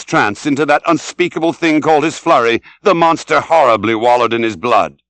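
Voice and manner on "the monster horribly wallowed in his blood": plain reading voice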